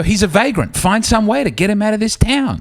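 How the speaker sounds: high mock-indignant order; officious townsfolk, acted. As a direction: act out police running off a drifter. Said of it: "They, they go".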